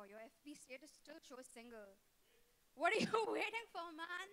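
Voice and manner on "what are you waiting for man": louder, higher, laughing through the question; laughing tease. Real, not acted. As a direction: tease the groom while cracking up.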